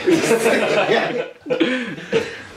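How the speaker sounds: laughing through burning pain; breathless pained laugh; pained laughter. Real, not acted. Mouth burning from the chip.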